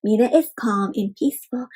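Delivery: usual speaking voice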